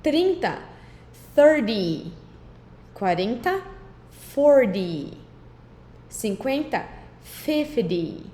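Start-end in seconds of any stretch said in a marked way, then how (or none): 1.3-2.1 thirty said "thurdy", T softened to a D; flapped T. Shown as a demonstration. Teacher showing students thirty with a D sound. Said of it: "fingir que é um D Y". 4.3-5.1 forty said "fordy", T softened to a D; flapped T. Shown as a demonstration. Teacher showing students forty with a D sound. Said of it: "fingir que é um D Y".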